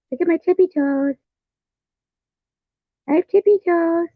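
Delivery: small, very high put-on voice; tippy-toe character. Figure showing off tippy toes, high put-on voice. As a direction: play it high and cutesy, on one held pitch.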